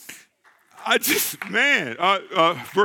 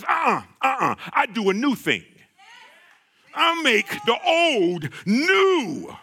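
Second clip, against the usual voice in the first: preaching at full force; 4 semitones higher than usual. Acted. Preacher voicing God, climax.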